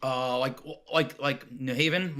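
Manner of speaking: normal talking voice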